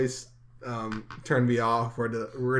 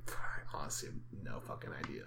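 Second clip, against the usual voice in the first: low breathless whisper; 11 semitones lower, 13 dB quieter than usual. Retold or imitated. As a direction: whisper it like a secret. Whispered confession in quote.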